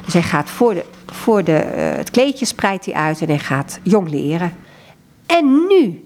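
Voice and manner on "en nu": bright raised "En nu", louder and higher; laughing, excited. A narrator's reading. Juggler laughing to the child, excited. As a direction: kick off the trick with a joyful burst. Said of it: "zei Giovanni lachend tegen het kind".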